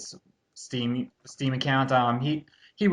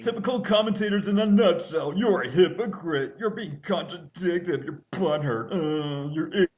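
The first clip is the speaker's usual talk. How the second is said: a higher mocking voice lightly put on, ranting; mock commenter; 6 semitones higher than usual. Parodying the commenters' stock insults.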